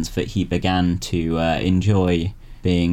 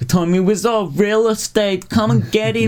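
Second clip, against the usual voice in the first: Tommy Wiseau ad voice; 12 semitones higher than usual. Pretend. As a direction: sell flats like a cheesy advert. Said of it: "I don't know what commercials for".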